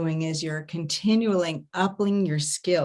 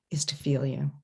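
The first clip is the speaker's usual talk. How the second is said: low, hushed and intimate; tender near-whisper; 5 semitones lower, 6 dB quieter than usual. Real, not acted. Coach on what everybody really wants, whispered tenderly. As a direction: drop to a soft whisper.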